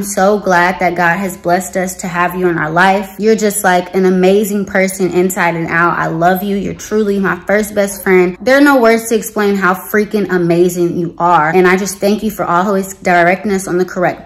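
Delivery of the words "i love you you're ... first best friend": ordinary talk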